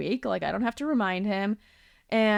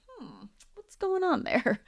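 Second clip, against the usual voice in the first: slow, high, wondering hmm; musing hmm; 11 semitones higher, slower than usual. Pretend. Speaker playfully questions her own repeated patterns, slow and curious. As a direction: puzzle over it aloud, drawn out.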